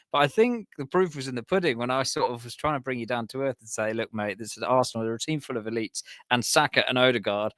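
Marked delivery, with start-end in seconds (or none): none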